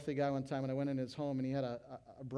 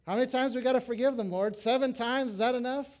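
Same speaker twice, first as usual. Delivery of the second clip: exasperated Peter; 10 semitones higher, 10 dB louder than usual. Retold or imitated. Peter quoted asking about forgiveness.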